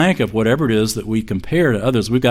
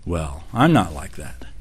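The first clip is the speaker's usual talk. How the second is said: smug pride; 6 semitones lower, 5 dB quieter than usual. Retold or imitated. Voicing proud person.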